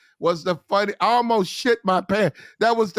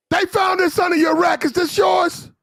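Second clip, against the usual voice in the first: mock officer yelling; 12 semitones higher than usual. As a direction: bellow at the sailor like a furious lieutenant. Lieutenant shouting at sailor.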